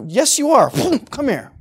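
mock firm parent hauling a kid along; insistent parent. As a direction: overrule a protesting child, lightly acted. Acts parent overruling protesting child.

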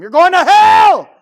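high, drawn-out full-force scream; huge condemning holler; yelling at people. Shown as a demonstration. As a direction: scream damnation at them, slowly. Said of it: "go yelling at people".

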